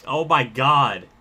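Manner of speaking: slow, loud disbelief; overwhelmed exclamation. Real, not acted. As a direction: gasp it out, floored. Overwhelmed by donation.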